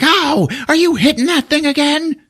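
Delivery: mock yelp. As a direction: yelp after the shock. Mechanic gets shocked.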